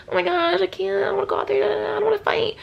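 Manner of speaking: high, fast whimpering impression; mock cowardly whine. Mocking powerful cowards hiding in shelters, whiny.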